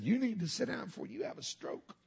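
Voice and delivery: high-pitched imitation of a fussing wife; scolding wife. Wife telling worked-up husband to sit down, high scolding imitation. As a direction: mimic her scolding, pitched well up. Wife's scolding voiced in story.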